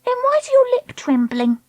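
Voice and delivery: high-pitched, taunting question; pry in a high child voice; needling. Acted. Treacle needling Dakota about her lip, taunting.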